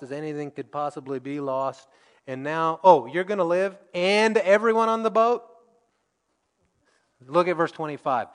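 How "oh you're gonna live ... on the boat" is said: raised, questioning mock surprise; acted disbelief. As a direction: pitch it up like news too good to believe. Acts out reaction to unlikely promise.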